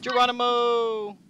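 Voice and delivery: loud, high, drawn-out holler; long daredevil whoop; battle cry. Real, not acted. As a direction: yell it as you leap. Battle cry while leaping in game.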